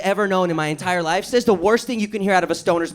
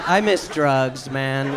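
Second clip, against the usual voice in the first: low, slow mock longing; mock wistful; 9 semitones lower, much slower than usual. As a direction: sigh it out like a lost love. Wistful confession.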